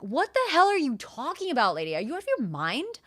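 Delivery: high, loud incredulous outburst; outraged disbelief. Retold or imitated. Client to coach naming huge sum, outraged disbelief. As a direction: swoop up high, aghast at the nerve.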